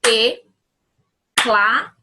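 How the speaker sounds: slow and loud, TE-CLA-DO, one syllable per clap; clapped syllables. Shown as a demonstration. Teacher to pupils demonstrating the clapping technique, syllable by syllable. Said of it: "eu vou fazer com a palavra teclado".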